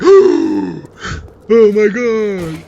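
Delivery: high, breathless oh my God; shocked gasp. Retold or imitated. Brother stunned after girlfriend's outburst, shocked. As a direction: react stunned and scared.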